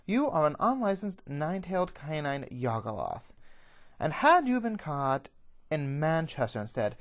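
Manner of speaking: loud, high formal condemnation; stern tirade. Acted. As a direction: pronounce judgement on the prisoner forcefully. Official berates the captured prisoner.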